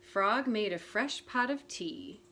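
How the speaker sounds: usual speaking voice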